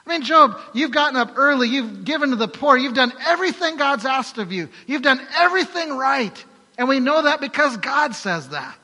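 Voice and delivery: high, loud impassioned preaching; full-force preaching. Real, not acted. Preacher pleads Job's case to the congregation, impassioned. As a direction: drive every line home to the room.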